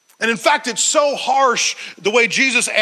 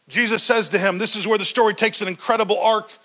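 ordinary talk, nothing marked

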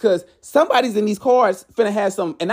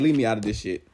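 much lower, quiet flat dismissal; a woman waving off the drama, low and quiet; 10 semitones lower, 5 dB quieter, faster than usual. Retold or imitated. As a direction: drop your voice low and wave it off. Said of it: "[name]'s like".

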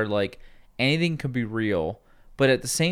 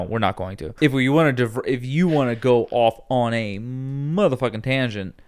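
ordinary delivery

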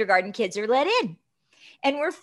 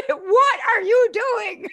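much higher, slow, laughing; laughing question; 9 semitones higher, 4 dB louder, slower than usual. Real, not acted. Guest imitates a loved one's grip, laughing. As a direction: laugh it out as a mock scold. Mock parent's scolding, laughing.

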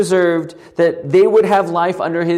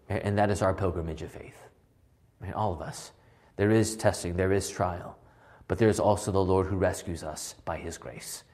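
much softer, lower, worn-out voice; voice giving out; 10 semitones lower, 7 dB quieter, faster than usual. Real, not acted. Teacher who yells all day, voice going, soft and strained. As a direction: let the voice fade and strain as it gives out. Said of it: "I know my voice is going".

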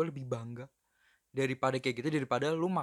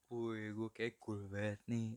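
low, soft, too-cool parody; mock self-admirer; 7 semitones lower, 13 dB quieter than usual. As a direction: play someone quietly pleased with how cool they are. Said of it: "mungkin ada beberapa orang bilang".

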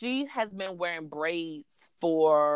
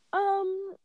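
daughter thinking hard. Retold or imitated. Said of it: "she's thinking hard".

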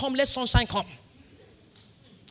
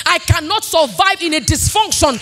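raised, loud preaching over music; impassioned sermon cadence; preaching at full force; 6 semitones higher, 7 dB louder than usual. Acted. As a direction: preach it to the congregation.

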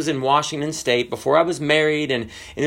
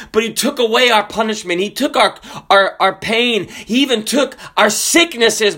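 rolling, higher-pitched pulpit cadence; fervent preaching; 5 semitones higher than usual. Real, not acted. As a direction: build it phrase on phrase like a revival preacher. Preacher builds on gospel message.

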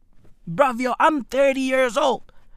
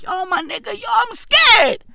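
mock scared reaction; 7 semitones higher than usual. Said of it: "I'm like".

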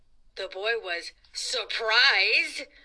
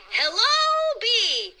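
high hello; 9 semitones higher, 5 dB louder, much slower than usual. Acted. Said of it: "He said".

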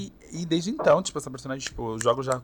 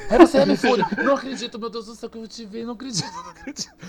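high squealing fan, louder, rushing; excited fan squeal; 10 semitones higher, 7 dB louder, faster than usual. Retold or imitated. A starstruck fan at Comic Con squealing, high and excited.

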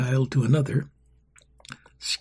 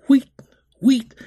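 1 whistle call imitation; 11 semitones higher, 7 dB louder than usual. A narrator's reading. Whistles blow, ranks close.

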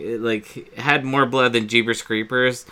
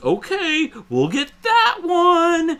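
much higher, slow cartoonish dad voice; 5 dB louder, slower than usual. Dad agreeing to buy the pumpkin, high slow mock voice. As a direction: give in to the kid, slowly and sing-song. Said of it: "Dad goes".

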